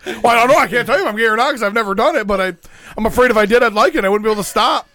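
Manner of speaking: loud, high-pitched imitation of a panicking friend; mock terrified. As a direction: blurt it out in exaggerated, worked-up worry. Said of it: "Like, he's just terrified".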